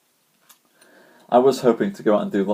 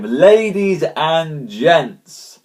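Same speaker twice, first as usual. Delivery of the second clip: very slow announcer call; 5 semitones higher, 4 dB louder, much slower than usual. Acted. Host calling to viewers, stretched announcer call. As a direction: stretch it out to the crowd.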